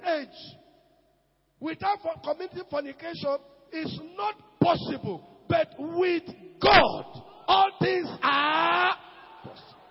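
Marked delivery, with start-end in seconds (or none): 5.5-9.8 slow, crying-out shout of faith; preaching climax. Acted. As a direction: stretch each word out in a loud cry. Climax of sermon.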